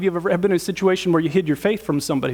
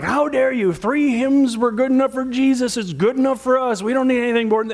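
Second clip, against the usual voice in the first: imitating outraged congregants, high-pitched scolding; mock outrage on behalf of the old guard; high, affronted protest, acted out; indignant churchgoers; 5 semitones higher than usual.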